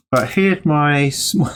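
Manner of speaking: talking around a paintbrush; words blocked by a brush in the mouth. Real, not acted. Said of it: "got my brush in my mouth".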